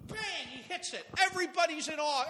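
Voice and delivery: ordinary talk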